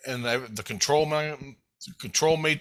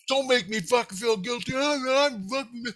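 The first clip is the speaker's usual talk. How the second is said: high, spluttering indignant protest; retold yelling; 9 semitones higher than usual. Kid warning his heart off, high-pitched mock rant. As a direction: rant at your heart in a high voice until the words run out. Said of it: "I'm yelling at him".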